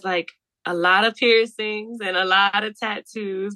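ordinary talk

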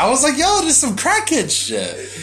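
high, loud laughing disbelief; incredulous outburst. Retold or imitated. Telling friend about the crack girl, shocked and laughing.

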